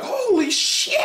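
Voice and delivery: very high, loud startled yell; squeaky teen shouting in shock; shocked shriek. Retold or imitated. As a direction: shriek in disbelief. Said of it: "he's like".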